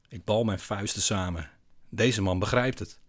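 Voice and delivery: ordinary delivery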